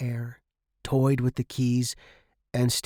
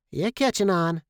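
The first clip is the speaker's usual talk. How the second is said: higher, knowing character voice; smirking Turner Arnold; 6 semitones higher than usual. A narrator's reading. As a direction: say it through a smirk. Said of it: "the midget smirked and said".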